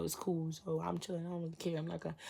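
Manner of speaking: soft, throwaway self-talk; feigned nonchalance. Acted. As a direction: act unbothered, barely projecting. Acting out excusing him again.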